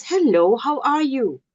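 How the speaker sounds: measured, sincere inquiry; caring greeting. Shown as a demonstration. Somebody who really wants to know, caring greeting. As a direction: ask as if you really want the answer. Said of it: "tone of voice that is caring".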